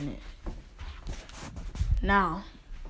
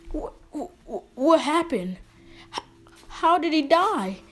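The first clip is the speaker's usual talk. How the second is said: dazed, high and halting, then louder; shocked stammer; 8 semitones higher, 5 dB louder than usual. Acted. Naruto coming to beside the dead man, shocked. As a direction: stammer it higher, rising to louder disbelief. Naruto finds he has killed someone.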